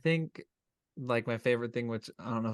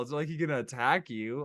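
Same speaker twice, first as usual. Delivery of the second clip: normal talking voice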